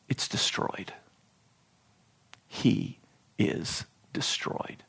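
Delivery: slow, breathy whisper of a grave word; solemn whispered reveal; hushed whisper. Acted. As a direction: let it drop to a whisper. Dramatic reveal of verse word.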